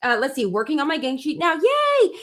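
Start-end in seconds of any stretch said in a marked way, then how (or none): 1.5-2.1 high, drawn-out yay; squealed little hooray, well above speaking pitch; happy cheer. Real, not acted. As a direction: cheer it high and long for the viewers. Cheers at viewers' comments.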